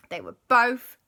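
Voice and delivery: ordinary delivery, nothing marked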